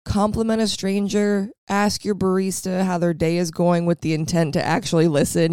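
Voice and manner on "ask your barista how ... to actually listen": normal talking voice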